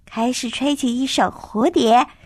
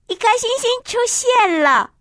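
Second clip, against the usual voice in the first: amazed; 7 semitones higher than usual. A narrator's reading. Child's glass star appears.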